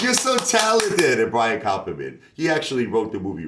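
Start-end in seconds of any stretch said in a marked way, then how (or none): 0.0-1.2 high, slow, gushing mock praise; swooning over the talent, drawn out. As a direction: gush it out like an impressed exec. A&R people praising him, mimicked.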